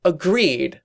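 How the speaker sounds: high, emphatic agreement; mild cry. A narrator's reading. Trixie agreeing they loathe each other, mild high cry. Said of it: "Trixie cried".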